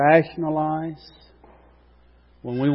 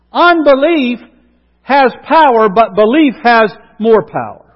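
rising into full-force preaching, louder and higher; 5 semitones higher, 7 dB louder than usual. Acted. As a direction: preach it out, building to a peak.

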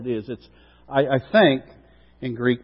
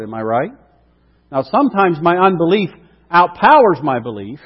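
usual speaking voice

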